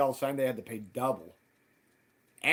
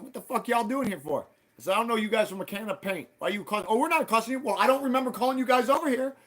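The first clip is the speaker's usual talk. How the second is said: angry retort; 9 semitones higher, 4 dB louder than usual. Retold or imitated. Retold confrontation with the men.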